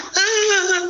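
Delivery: real laugh jumping way up high; high burst of laughter. Real, not acted. Laughing at [name]'s post about her crying son. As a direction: crack up high at the memory.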